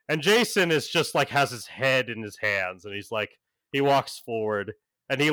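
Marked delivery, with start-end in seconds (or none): none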